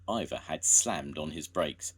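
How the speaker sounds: usual speaking voice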